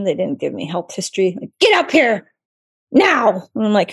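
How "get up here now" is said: higher, sharp mock order; retold yelling. Rude caller ordering the resident up, imitated yelling. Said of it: "They're yelling at me".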